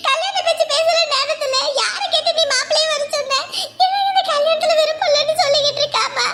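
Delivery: high-pitched, aggrieved mother pouring out complaints; indignant mother. Acted. Mother complaining to relatives about her daughter, high and aggrieved.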